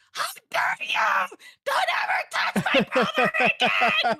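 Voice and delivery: screaming like a crazy person, retold at full force; high, loud shriek, drawn out, breaking into laughter; crazed angry yelling. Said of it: "like a fucking crazy person".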